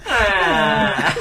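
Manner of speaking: drawn-out cartoon wail on the edge of laughter; imitated yell. Imitating the zoom-in on the kid, mock yell. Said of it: "just zooms on him and goes".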